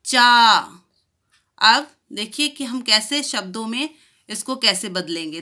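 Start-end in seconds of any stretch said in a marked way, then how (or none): 0.1-0.6 चा louder with a long aa. Shown as a demonstration. Teacher leading children to repeat चा. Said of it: "आप मेरे साथ बोलिए".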